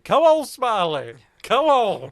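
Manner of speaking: loud, high, drawn-out movie yell; Ford Fairlane. Retold or imitated. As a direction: bellow it as if mid car chase. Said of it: "Ford Fairlane starts yelling".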